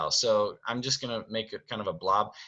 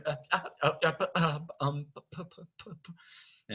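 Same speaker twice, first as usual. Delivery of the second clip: higher stuttered nonsense syllables, fading away; Cogsworth muttering; 6 semitones higher, 4 dB quieter than usual. Acted. Servant fretting while Beast asks Belle, muttering. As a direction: sputter wordlessly, trailing off. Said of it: "Cogsworth starts muttering".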